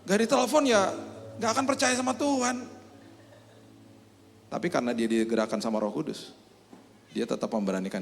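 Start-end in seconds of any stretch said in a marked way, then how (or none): 0.1-2.6 high-pitched, worried dithering; acted anxious second thoughts; hesitant doubt. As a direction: fret aloud in a high voice. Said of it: "Itu anak Tuhannya kan ragu-ragu".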